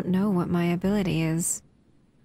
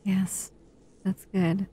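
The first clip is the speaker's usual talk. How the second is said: normal talking voice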